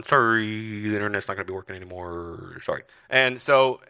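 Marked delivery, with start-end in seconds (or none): 0.0-2.8 flight announcement. Retold or imitated. Crew telling passengers the internet broke, low announcer voice. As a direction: mimic cabin crew apologising over the intercom. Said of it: "so they get back on".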